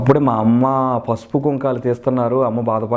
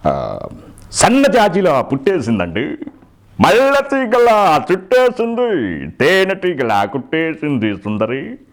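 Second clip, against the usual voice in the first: swooping, drawn-out villain-actor delivery; theatrical impression with punctuating 'aa's; Prakash Raj; 7 semitones higher, much slower than usual. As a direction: toy with each line, pitch leaping up then dropping. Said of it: "Prakash Raj గారు".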